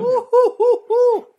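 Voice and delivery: high, loud drawn-out whoop. Real, not acted. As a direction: whoop after the shot. After a shot of liquor.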